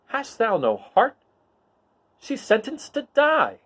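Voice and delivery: high, slow heroic appeal; young Luke pleading. Acted. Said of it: "And Luke says".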